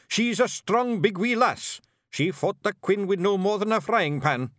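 high, loud Scottish insistence; emphatic, fired-up clan chief; defiant shout. A narrator's reading. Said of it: "shouted Rob Anybody".

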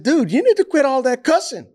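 high-pitched, emphatic retold scolding; straight-up telling off. Man telling a cussing coach to stop, straight-up telling off. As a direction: re-enact a blunt telling off. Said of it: "I told him straight up".

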